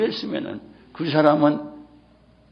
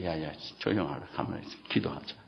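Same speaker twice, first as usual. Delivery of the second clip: soothing hush; 8 semitones lower, 9 dB quieter, faster than usual. Retold or imitated. Pastor calming weeping man.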